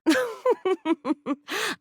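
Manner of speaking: a short, high, teasing laugh; playful giggle; flirty laugh. Acted. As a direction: laugh as if caught in the act. Flirtatious character greets player.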